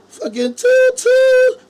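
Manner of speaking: sung way up high and slow like a party horn; mock horn toot. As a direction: toot it out like a goofy celebration.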